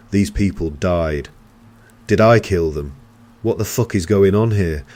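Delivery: loud, furious demanding; angry roar. A narrator's reading. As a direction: hurl the accusation. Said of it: "I roared".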